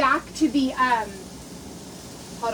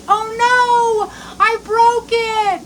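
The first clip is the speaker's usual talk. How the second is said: higher, louder mock dismay; retold dismay; 9 semitones higher, 3 dB louder, much slower than usual. Broke the selfie stick, telling viewers her dismay, higher pitched. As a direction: play up the little panic, high and slow. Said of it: "So I am like".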